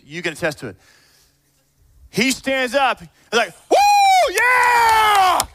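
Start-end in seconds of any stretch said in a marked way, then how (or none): 3.7-5.4 very high, loud whooping cheer; rowdy cheering dad. Acted. A dad standing up at the school assembly, wild cheering shout. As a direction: whoop it out at full volume. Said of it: "Just like that".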